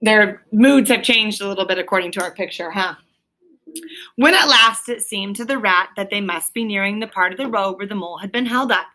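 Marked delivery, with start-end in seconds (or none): none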